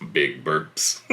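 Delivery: low, belchy rumble; a playful low burp sound; deep burp voice. Acted. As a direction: drop low and rumbly for the burps. Hare burping, deep burp voice.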